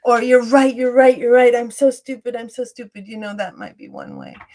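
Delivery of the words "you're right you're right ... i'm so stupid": louder, hurried self-blame fading out at the end; anxious agreement. Acted. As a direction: cave in fast to the critic. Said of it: "initial gut reaction".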